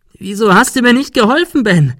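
high, loud accusing cry; shrill reproach. A narrator's reading. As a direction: scream the blame at him. Sarah reproaches Ben. (hoher, lauter Vorwurf)

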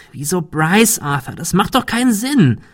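scharf, gegen Ende deutlich höher. A narrator's reading. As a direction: schneide ihm scharf das Wort ab. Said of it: "Cecil sprach scharf". (scharfer, kritischer Ton)

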